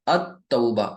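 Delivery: अत-तौबा with doubled t and no l sound; अत-तौबा with the ta geminated; Arabic pronunciation. Shown as a demonstration. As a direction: say अत-तौबा, lam silent, ta doubled. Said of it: "लाम को बिल्कुल भी pronounce नहीं कर रहे".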